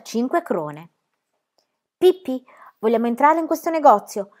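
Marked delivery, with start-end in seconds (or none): 2.0-4.3 eager, louder and higher; excited boy's question; enthusiastic. Acted. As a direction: tug at her with excitement. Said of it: "disse Tommy con entusiasmo".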